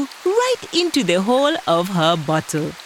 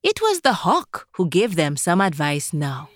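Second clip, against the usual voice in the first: ordinary delivery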